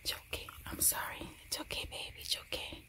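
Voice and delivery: softly whispered comfort. Real, not acted. Mom soothing dog during thunder, whispered. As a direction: hush everything for the scared dog.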